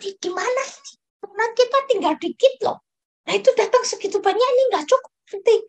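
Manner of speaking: high, hurried fretting; panicky worrying. Retold or imitated. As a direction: friend panicking that the donuts will run out. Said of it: "aku bingung sama Tin".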